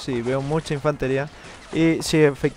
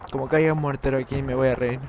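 usual speaking voice

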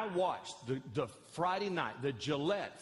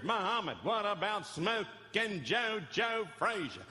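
higher, slow sportscaster cadence; Howard Cosell; 4 semitones higher than usual. Retold or imitated. Pastor to Muhammad Ali, doing Howard Cosell. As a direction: do Cosell calling the fight. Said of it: "I went into Howard Cosell and went".

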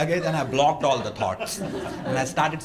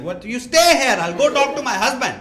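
loud retold order; 10 semitones higher, 4 dB louder than usual. Angry guest orders the comic to stay before fetching her husband, loud. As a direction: imitate her high, loud outburst. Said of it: "Thank you for saying it that loud".

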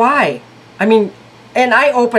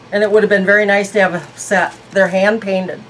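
normal talking voice

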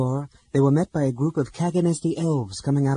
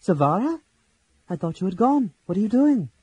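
high, louder surprised call; startled; 7 semitones higher, 6 dB louder than usual. A narrator's reading. Laurana startled to find Silvara in the cave, high and louder. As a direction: caught off guard in the dark.

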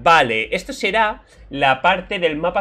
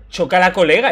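Pathfinder catchphrase; 4 semitones higher, 7 dB louder than usual. Retold or imitated. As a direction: greet them like the eager robot. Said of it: "chócala es el high five de Pathfinder". (imitando a Pathfinder, saludo alegre y más alto)

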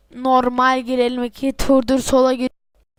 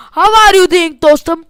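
loud, high put-on English hello; hammed-up taunting greeting; mock English greeting; 5 semitones higher, 9 dB louder than usual. Gamer taunting an enemy player, mock English greeting.

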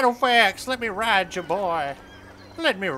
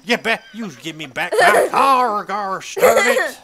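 mock angry demand; 2 semitones higher, 11 dB louder than usual. Demands his car back.

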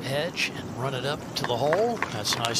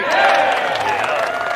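excited yell; 11 dB louder than usual. Real, not acted. Reaction to putt dropping.

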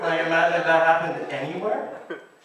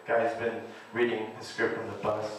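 normal talking voice

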